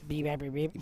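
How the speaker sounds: lightly voiced robot beeps; flat mechanical beeping, a hint of robot. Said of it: "That's my robot voice".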